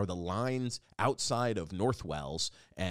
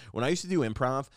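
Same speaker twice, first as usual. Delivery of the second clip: normal talking voice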